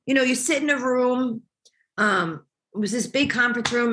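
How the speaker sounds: ordinary delivery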